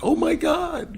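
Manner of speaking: very high, loud squeal of shock; delighted surprise. Retold or imitated. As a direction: imitate her thrilled gasp at the door. Girlfriend opens door, surprised.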